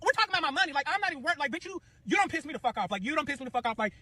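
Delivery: high-pitched furious tirade; re-enacted screechy argument at full tilt; livid. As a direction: rage at him and shove him away with the words.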